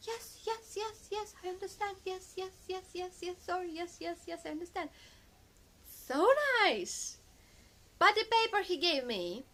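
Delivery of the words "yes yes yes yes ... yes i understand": higher, softer, eager-to-please yeses on the phone; lightly mimicked groveling doctor; meek and apologetic. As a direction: imitate a doctor suddenly all polite and submissive. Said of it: "He was so nice".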